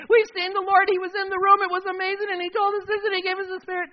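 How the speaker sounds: high, loud, breathless-style gush; excited report. Acted. Disciples telling Thomas they saw the Lord, high excited gush. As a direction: blurt it all out in a high-pitched rush.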